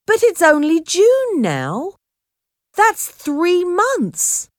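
high-pitched, baffled protest; bewildered, stretching the last words out; confused exclamation. A narrator's reading. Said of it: "He was confused".

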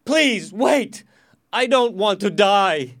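loud, high, drawn-out begging; desperate plea. Acted. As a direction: beg for your life at full voice. Prisoner begs for his life.

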